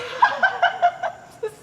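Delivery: high, helpless cackle; gleeful laughter. Acted. Nurse delighted by her own joke, gleeful laughter. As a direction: burst out laughing at your own story.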